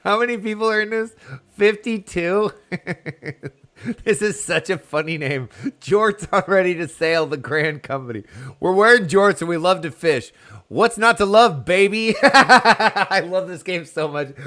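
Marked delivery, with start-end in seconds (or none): 0.0-3.5 high, loud and laughing through the words; delighted laughing disbelief. Real, not acted. Laughing at a funny name. 8.6-14.4 higher and louder, gushing with laughter; bubbling over with glee; giddy fan squeal; excited. Real, not acted. Gushing over funny game names.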